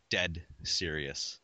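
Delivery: a pretend solemn vow to get serious; mock dead serious. Shown as a demonstration. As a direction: play it grave and slow, tongue in cheek. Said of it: "dead serious now".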